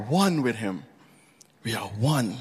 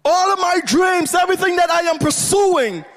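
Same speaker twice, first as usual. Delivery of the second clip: high, sustained preacher's cry; impassioned pulpit fervour, pitched far up; full-force preaching; 13 semitones higher than usual. Real, not acted. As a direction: build to a soaring, shouted declaration.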